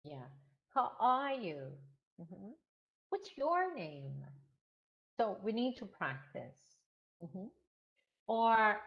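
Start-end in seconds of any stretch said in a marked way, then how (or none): 0.7-4.2 slow, falling on you and name; wh-question tune. Shown as a demonstration. Teacher drilling WH questions, slow and clear. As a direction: model each question slowly. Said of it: "what about W H questions".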